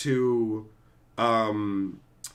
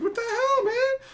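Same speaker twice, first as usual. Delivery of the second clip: much higher startled yelp with a laugh; mock startled squeak. Old Highlander attacked with a bottle, high startled yelp. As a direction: squeak it in alarm. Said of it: "He's like".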